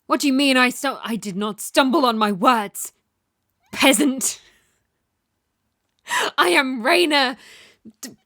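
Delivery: ordinary delivery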